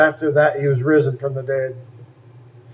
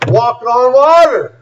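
higher, building on WATER; excited exclamation; 7 semitones higher, 4 dB louder, slower than usual. Real, not acted. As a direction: marvel loudly at it, preacher style. Preacher exclaims Peter's feat.

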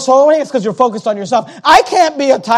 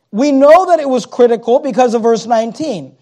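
normal talking voice

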